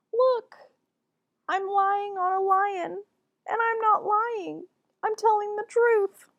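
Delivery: high, loud playful boast; excited child. Acted. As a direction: show off gleefully on the lion. Child shows off on lion.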